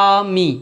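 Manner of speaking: আমি broken as আ + মি; two detached beats for আমি, gap after আ; split into syllables. Shown as a demonstration. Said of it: "দুটো সিলেবেলে ভাগ হচ্ছে". (আমি শব্দটা আ আর মি, দুই ভাগে থেমে)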